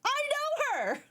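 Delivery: high excited quote; excited squeal. Retold or imitated. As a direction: squeal it like spotting a friend. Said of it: "I was like".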